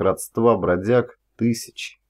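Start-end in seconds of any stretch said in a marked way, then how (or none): none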